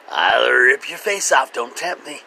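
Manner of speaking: loud, menacing cat voice; a grumpy cat threatening her owner; voicing the cat. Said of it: "she's like".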